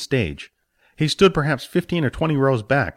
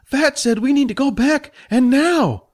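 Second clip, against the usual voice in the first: high urgent command; urgent mentor; 4 dB louder than usual. Acted. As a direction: insist firmly. Jack relays orb message.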